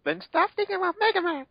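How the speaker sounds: very high, put-on passionate cry. Mail urges Roll to forget Mega Man, very high and hammy. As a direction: squeal it way up high like a melodrama heroine. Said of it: "she said in a passionate voice".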